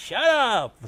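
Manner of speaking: loud, high-pitched heckle; shout. A narrator's reading. Vampire-side pony silencing a fairy supporter, high loud shout. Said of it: "some pony on a vampire side shouted".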